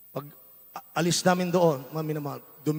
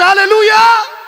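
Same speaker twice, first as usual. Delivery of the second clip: a full, high shout of praise; shouted hallelujah; 16 dB louder, slower than usual. Real, not acted. Preacher crying out to the congregation, ecstatic shout. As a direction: throw the hallelujah up to the rafters.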